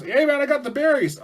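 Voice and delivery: high, loud breathless urgency; frantic acted delivery; urgent shout. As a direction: holler it as if chased. Hunter rushes back with berries.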